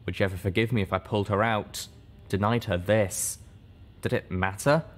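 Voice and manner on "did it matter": usual speaking voice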